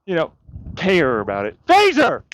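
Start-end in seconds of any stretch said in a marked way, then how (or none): 1.7-2.2 high, loud yell of the dog's name; calling the dog. Real, not acted. Owner yelling for his dog Phaser who ran off, loud sharp call. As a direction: shout the dog's name sharply across the yard.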